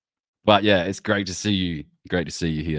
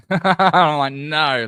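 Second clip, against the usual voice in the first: laughing; 10 semitones higher, 8 dB louder, much slower than usual. Real, not acted. Laughing at own emotion.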